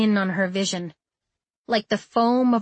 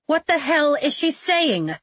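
demanding; 6 semitones higher than usual. A narrator's reading. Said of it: "Nicola demanded".

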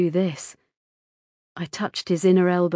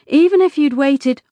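louder, higher, urgent insistence; insistent reassurance; 7 semitones higher, 8 dB louder than usual. A narrator's reading. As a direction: press on over his objection. Mother insists over son's protest.

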